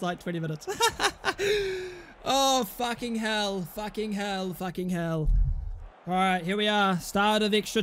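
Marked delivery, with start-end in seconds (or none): 0.7-2.0 high burst of laughter. Real, not acted. Reacting to the match.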